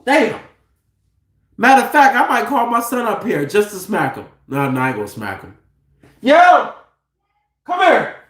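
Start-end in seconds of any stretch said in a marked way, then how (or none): 4.5-5.6 fast, low, hushed take-back; quick quiet aside. Real, not acted. Quick aside after threat. 6.2-8.2 calling out. Real, not acted. Calling son from upstairs.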